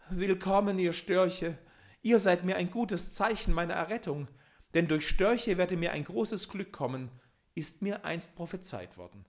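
high, loud joyful welcome; joyful cry. A narrator's reading. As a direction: cry out with relief. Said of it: "lautes Freudengeschrei".

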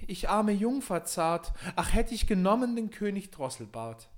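lament. Acted. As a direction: mourn the lost king in a high voice. Klagende Prinzessin. (hohe, langsame Klage der Prinzessin)

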